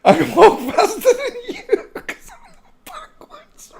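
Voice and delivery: real laughter swallowing the words, slowing to a wheeze; helpless laughter. Real, not acted. Host mimicking a silly clip, breaking up laughing. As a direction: try to quote it while collapsing with laughter.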